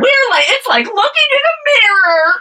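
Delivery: a delighted shriek of recognition, hammed up; mock squeal. To her co-host about looking like Reese Witherspoon, a giddy high squeal.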